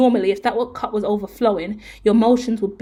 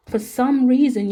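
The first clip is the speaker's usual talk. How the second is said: normal talking voice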